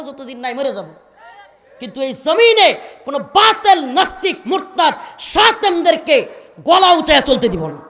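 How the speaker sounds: full-force Bengali waz preaching; fiery sermon. Real, not acted. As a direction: rouse the crowd with booming verses. Fiery preaching with Quranic recitation.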